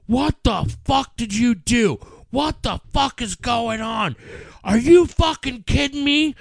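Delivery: high, shouted mock fury; wife yelling. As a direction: yell it like the furious mom finding the mess. Wife yelling at son's mess.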